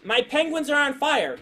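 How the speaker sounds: mock child's excited announcement; imitating a gleeful kid. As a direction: play the kid proud of a ridiculous idea. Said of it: "they laugh".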